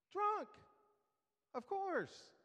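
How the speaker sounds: spring the punchline up high; a sudden high squeak of a punchline; mock shocked. Punchline, pitch jump.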